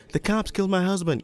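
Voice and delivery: shrill it out like the distraught widow; screaming wife. Retold or imitated. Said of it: "the victim's wife keeps screaming".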